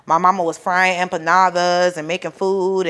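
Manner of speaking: usual speaking voice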